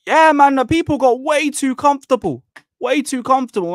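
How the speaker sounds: high, incredulous rant; disbelieving outburst; exasperated. Real, not acted. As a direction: scoff at the people in chat. Venting at chat telling him.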